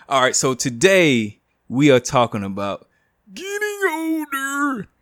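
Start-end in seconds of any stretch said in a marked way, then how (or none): 3.3-4.9 put-on quavering elderly drawl, slow; old man voice. Old man voice announcing topic.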